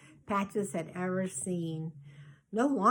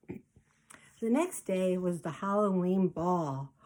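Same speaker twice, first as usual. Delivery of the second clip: plain reading voice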